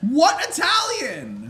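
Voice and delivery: loud, slow disbelieving shout; incredulous shout. Real, not acted. Friend shouting in disbelief about the accent. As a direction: yell it in disbelief.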